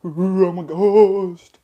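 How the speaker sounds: slow, drawn-out mock ghost wail; spooky ghost. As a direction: stretch it out like a cartoon ghost. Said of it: "You know, ghost things".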